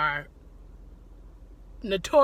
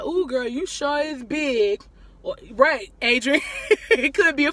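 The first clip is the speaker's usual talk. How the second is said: slow, drawn-out gushing auntie voice; nosy lady comment; 6 semitones higher, 7 dB louder than usual. Retold or imitated. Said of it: "innocent of comments, like".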